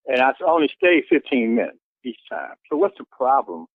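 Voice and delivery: usual speaking voice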